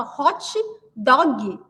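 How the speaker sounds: slow "hótchi dógui" for "hot dog"; Brazilian pronunciation. Retold or imitated. Repeating it to the puzzled waiter, slow Brazilian pronunciation. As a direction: draw out "hot dog" with an i ending each word. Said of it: "a gente coloca o i no final".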